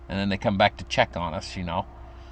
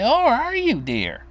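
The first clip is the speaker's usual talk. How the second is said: put-on sweet old grandma; 13 semitones higher, slower than usual. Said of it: "And she'd say".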